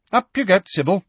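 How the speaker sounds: crisp and businesslike; McGonagall voice. Acted. McGonagall agreeing to escort Sybil, brisk and crisp.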